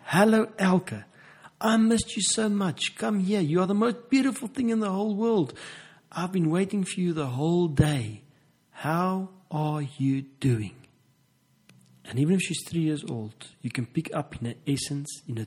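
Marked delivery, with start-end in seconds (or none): 0.1-10.8 high, bright, louder hello full of joy; excited greeting. Shown as a demonstration. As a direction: greet her like she's the best thing in the world. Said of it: "with anticipation and excitement and say".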